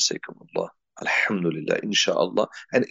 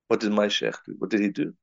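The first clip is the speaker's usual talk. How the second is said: usual speaking voice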